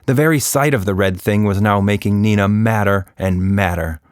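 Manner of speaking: plain reading voice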